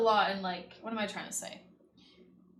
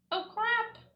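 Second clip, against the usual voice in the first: high, loud burst of alarm; startled exclamation; 14 semitones higher, 5 dB louder than usual. Real, not acted. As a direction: blurt it out high in sudden alarm. Sudden mishap while painting.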